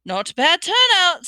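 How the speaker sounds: high, loud self-satisfied remark; proud. A narrator's reading. Ghost host surveying his party, proud. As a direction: boast with pleased pride. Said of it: "said Nearly Headless Nick proudly".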